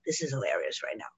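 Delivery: low, soft aside; amused inner voice. Retold or imitated. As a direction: drop low and quiet for a retold private thought. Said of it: "another part that's like".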